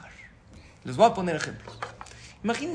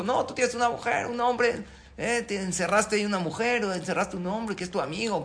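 bickering; 6 semitones higher than usual. Acted. Spouses argue.

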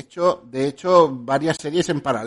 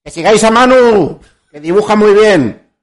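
loud, raised voice; shouting; 8 semitones higher, 19 dB louder than usual. Real, not acted. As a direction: shout it out so they hear. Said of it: "Habla más alto".

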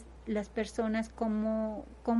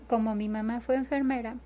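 ordinary delivery

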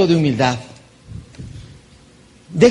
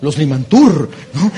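ordinary talk, nothing marked

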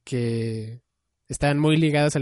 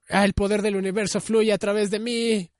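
hammed-up anime hero; 7 semitones higher than usual. Host parodying a character summoning power, hammed-up heroic cry. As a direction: proclaim it like a cartoon hero channeling power. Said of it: "era como".

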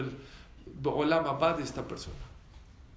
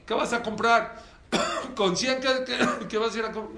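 higher, louder scoffing question; mocking merchant; 6 semitones higher, 4 dB louder than usual. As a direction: scoff at his hundred rubles. Merchants mocking the poor man.

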